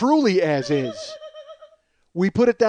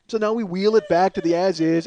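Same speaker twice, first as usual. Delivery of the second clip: ordinary talk, nothing marked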